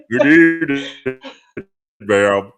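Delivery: loud, hammed-up gentlemanly hello; exaggerated polite opener, starting high; mock-formal greeting. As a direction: greet the lady with playful ceremony. Repeats mock-polite greeting to a woman.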